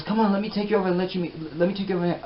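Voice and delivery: high, fast imitation of an eager friend; imitated excited invitation; eager urging. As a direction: rush it out high, pulling someone along. Acting friend eager to introduce him.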